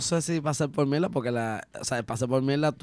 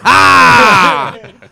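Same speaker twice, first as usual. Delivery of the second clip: huge high, loud mock guffaw; hard laugh in the face; 10 semitones higher, 22 dB louder than usual. Guest imagines laughing in a kid's face, loud mock guffaw. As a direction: laugh long and loud right at them. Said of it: "Yo me reiría tan duro en su cara".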